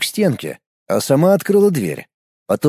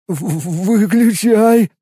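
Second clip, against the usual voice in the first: shivering, chattering stammer; teeth chattering; 6 semitones higher, much slower than usual. A narrator's reading. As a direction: stutter through chattering teeth. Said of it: "стуча зубами".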